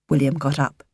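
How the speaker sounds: usual speaking voice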